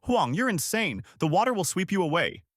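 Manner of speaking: high, urgent warning cry; shouting. A narrator's reading. Villager racing after Huang, high alarmed shout.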